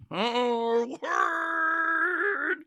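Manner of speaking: high, long drawn-out comic scream; mock inner scream. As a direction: scream it like a voice inside your brain. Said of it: "I scream it in my brain".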